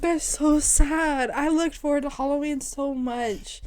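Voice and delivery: high, slow mock crying; fake-sob the words; hammed-up whimper. Friend mock-cries over her co-host's childhood, high and slow. Mock pity while hugging.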